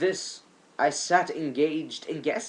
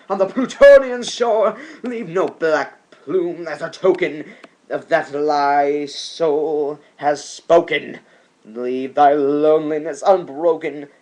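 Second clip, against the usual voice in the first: louder, higher, frantic; shrieking command; 3 semitones higher, 9 dB louder than usual. Acted. Poet ordering the raven out, loud and frantic. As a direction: drive the bird away in fury. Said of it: "I shriek, upstarting".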